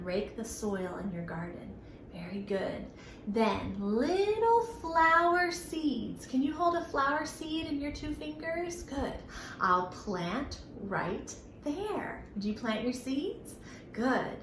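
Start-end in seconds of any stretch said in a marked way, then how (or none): 3.3-6.2 rhyme recital. Shown as a demonstration. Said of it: "this next little rhyme". 9.6-12.2 rhyme recital. Shown as a demonstration. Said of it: "this next little rhyme".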